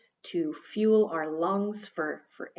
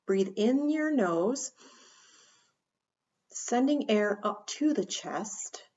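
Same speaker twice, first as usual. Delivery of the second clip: slow, calm breathing cue; slow guided breathing; slower than usual. Shown as a demonstration. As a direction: draw it out like a breath. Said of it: "coach demonstrates slow breathing".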